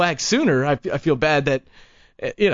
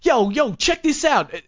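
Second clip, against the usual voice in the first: pushy CD hustler; 8 semitones higher than usual. Retold or imitated. Pitching his CD to a passerby, hustler voice.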